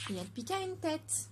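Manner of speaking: ordinary delivery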